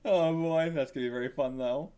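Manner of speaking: usual speaking voice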